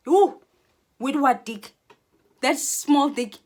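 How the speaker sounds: high, loud sneering taunt; loud disdainful scoff; scornful mockery. Real, not acted. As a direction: jeer at him with contempt. Mocking rival's man.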